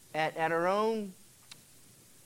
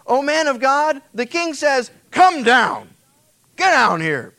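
loud barked military command; shouted order; 8 semitones higher, 11 dB louder than usual. Retold or imitated. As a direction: bark it down the hill like a captain. Captain barks king's order.

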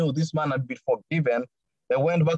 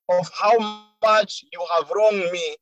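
normal talking voice

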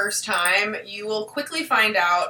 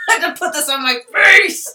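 laughing; 8 semitones higher, 6 dB louder than usual. Retold or imitated. Laughing retelling own reaction.

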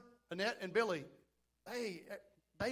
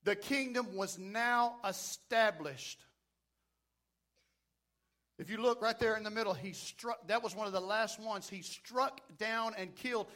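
ordinary delivery

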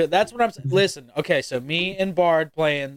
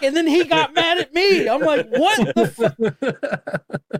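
high-pitched, louder, amused disbelief; incredulous retelling; 11 semitones higher, 3 dB louder, much slower than usual. Retold or imitated. Streamer telling friends how a kid got mad, incredulous. Retelling the kid's angry reaction.

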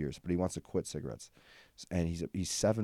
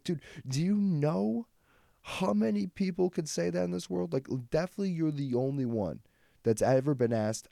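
amazed disbelief; 11 semitones higher, 4 dB louder than usual. Retold or imitated. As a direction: marvel at him, voice climbing. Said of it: "I'm like".

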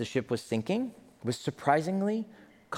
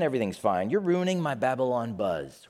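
normal talking voice

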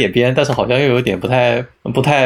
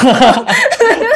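high burst of laughter; 4 dB louder than usual. Real, not acted. Laughs at long review joke.

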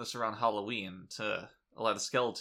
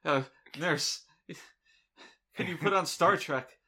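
dazed patient voice; 6 semitones higher, 5 dB louder than usual. Pretend. Imagined patient asks nurse.

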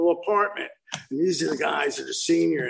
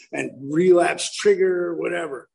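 normal talking voice

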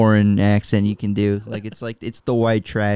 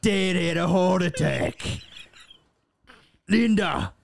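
higher, strained Cockney with long pauses; Arthur; 6 semitones higher than usual. Acted. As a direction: haltingly break news in hammed-up Cockney. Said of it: "Arthur, uh, and you have to go tell".

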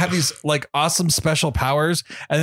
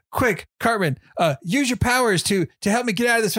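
put-on kid voice; 7 semitones higher than usual. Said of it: "having, like, the kid voices".

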